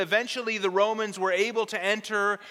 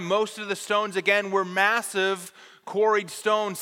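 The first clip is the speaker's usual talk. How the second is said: usual speaking voice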